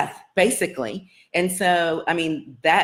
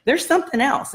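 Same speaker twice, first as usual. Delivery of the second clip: high-pitched quoted insistence; high, knowing announcement; insistent healer; 11 semitones higher than usual. Retold or imitated. Voicing the healer's insistent claim.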